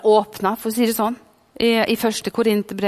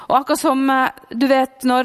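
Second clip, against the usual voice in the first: ordinary talk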